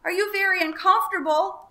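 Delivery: high, loud shouted question; screaming over the storm. A narrator's reading. Elmer shouts to the dragon over the storm, loud. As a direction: scream it above the noise. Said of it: "he screamed above the noise".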